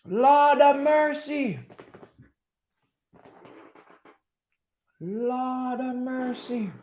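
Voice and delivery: high, slow, stretched-out wailing to the heavens; mock preacher lament. Lamenting Casemiro's red card, a slow high mock-preacher wail. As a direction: moan it like a despairing church elder.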